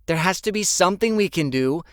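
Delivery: loud, high desperate plea. Acted. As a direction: plead with rising panic.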